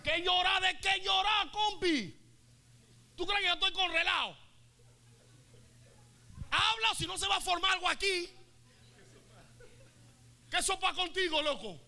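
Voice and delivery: tough Río Abajo woman. Acted. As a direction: threaten them like a tough from the barrio. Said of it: "María Magdalena de Río Abajo".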